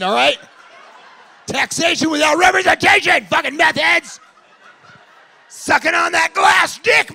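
loud, high, laughing tirade; whipped-up crowd-work rant; mock shouting rant. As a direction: yell it like a drunk hooligan. Mock rant against England.

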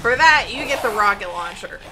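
loud. Real, not acted. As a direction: bark it out in triumph. Revenge on a monster.

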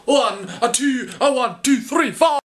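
high, rising bandleader count-in in mock Scottish; rousing count-in. Baker counting himself into the baking, rousing mock-Scottish count-in.

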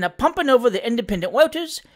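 matter-of-fact Cockney servant telling a tale; Sam Weller, cheeky manservant. Acted. Valet reporting election tricks to his master, casual Cockney. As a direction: report it casually in lively dialect.

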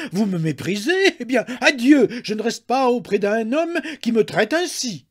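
very high, loud, stammering outrage; indignant screaming. A narrator's reading. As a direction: shriek it, choking with indignation. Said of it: "elle s'indigna, hurlant".

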